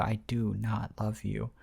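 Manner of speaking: slow, very soft murmur; mock dreamy mumbling, drawn out quietly; acted sleep talk. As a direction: murmur it as if still asleep. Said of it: "he's dreaming, he's dreaming about me".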